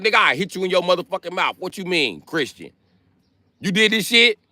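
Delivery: hammed-up God scolding Jesus. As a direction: threaten your son like an angry dad. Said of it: "telling Jesus, like".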